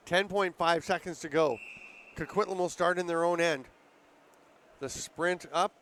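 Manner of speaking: ordinary talk, nothing marked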